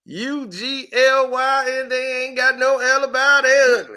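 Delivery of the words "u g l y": each letter drawn out slow; spelled out slowly. Retold or imitated.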